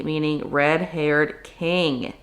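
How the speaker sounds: normal talking voice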